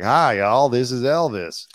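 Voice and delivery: loud folksy Southern greeting, lightly put on; Colonel Tom Parker. Imitating the colonel's old video in a loud Southern voice. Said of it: "he sounds like Austin Butler".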